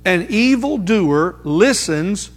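very slow, word-by-word emphasis; deliberate verse reading. Real, not acted. Preacher reading a proverb to the church, slow and deliberate. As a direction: draw out each word of the verse so it sinks in.

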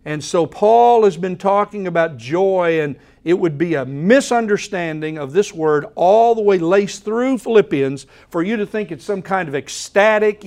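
ordinary talk

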